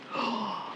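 soft breathy gasp; quiet, sharp intake of breath. Pretend. Teacher introducing the next picture, soft gasp.

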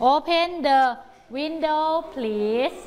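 window please drawn out; slow model reading. Shown as a demonstration. Teacher modelling sentence for pupils. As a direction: let window please stretch slowly. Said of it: "ประโยคนี้จะต้องอ่านว่า".

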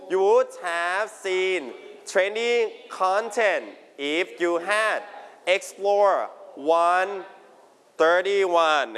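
"You would have seen trending content" read very slowly, word by word; slow reading aloud. Shown as a demonstration. Teacher leads the class through the sentence slowly. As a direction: spell it out slowly so the class can follow. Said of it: "can you read the example sentence".

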